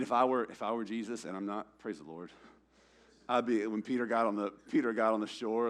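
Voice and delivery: normal talking voice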